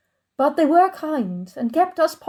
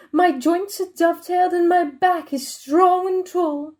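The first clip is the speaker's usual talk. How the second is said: normal talking voice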